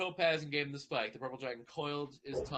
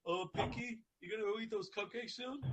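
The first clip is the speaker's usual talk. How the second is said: higher, softer dragon voice; 9 semitones higher, 4 dB quieter than usual. Acted. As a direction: ask sweetly as the baby dragon.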